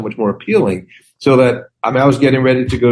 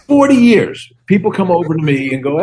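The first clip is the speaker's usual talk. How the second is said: ordinary talk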